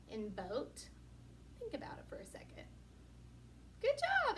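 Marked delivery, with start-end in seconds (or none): 1.6-2.6 breathy, fast whisper. Acted. Teacher pauses for pupils. 3.8-4.4 excited praise. Acted. Praising unseen pupils.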